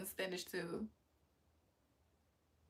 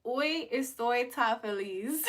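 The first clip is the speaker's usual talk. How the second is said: slow, deliberate hoy estoy tan feliz; slow careful Spanish; 2 semitones higher, slower than usual. Shown as a demonstration. Streamer trying Spanish for chat, slow and careful. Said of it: "I said I'm so happy".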